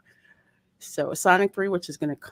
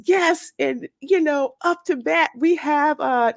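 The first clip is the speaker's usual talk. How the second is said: higher, announcer-style call of a batter; sports broadcaster; 8 semitones higher than usual. Retold or imitated. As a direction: mimic a ballpark announcer introducing a hitter. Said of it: "one of the sports broadcasters says".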